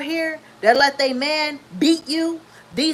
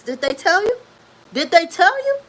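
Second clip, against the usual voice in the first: repeated question pushed higher and louder; rising challenge; 6 semitones higher, 5 dB louder than usual. Real, not acted. Challenging her viewers, louder and higher. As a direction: demand an answer, louder the second time.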